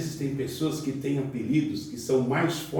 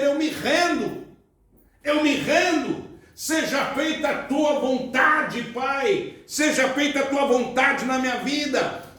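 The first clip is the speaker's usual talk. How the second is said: fervent higher-pitched prayer; 9 semitones higher than usual. Acted. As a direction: pray in surrender. Prayer of surrender.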